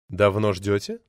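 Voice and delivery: low-pitched woman's question. A narrator's reading. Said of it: "спросила она низким голосом". (низким голосом)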